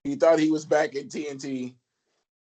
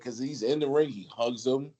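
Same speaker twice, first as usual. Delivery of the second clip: ordinary talk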